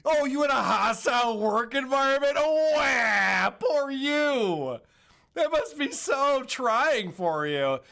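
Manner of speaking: loud, high mocking pity; mock sympathy. Host retelling Carl goofing on Opie, mocking pity. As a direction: goof on him with fake sympathy. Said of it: "Carl is totally goofing on Opie".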